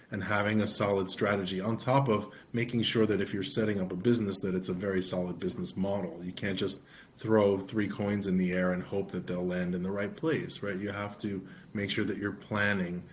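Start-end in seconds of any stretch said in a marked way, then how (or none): none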